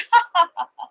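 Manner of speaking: high mocking laugh. Friends laugh at the red cat, mocking laugh. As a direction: laugh at her. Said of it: "started laughing at her".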